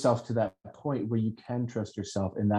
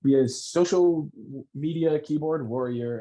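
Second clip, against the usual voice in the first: much higher, whiny poor-me tone; mock victim voice; 4 semitones higher than usual. A host mocks online complainers with a whiny victim voice. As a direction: sneer like a self-pitying troll. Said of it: "they're like".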